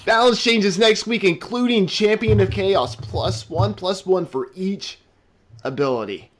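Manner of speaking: high, loud headline announcement; announcer. Acted. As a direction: proclaim it like a show host reading out big news. Host announces the next topic.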